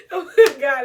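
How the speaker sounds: loud, high words through real laughter; cracking up; laughing hard. Real, not acted. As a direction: gasp it out mid-laugh. Still laughing at her story.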